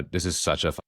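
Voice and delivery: usual speaking voice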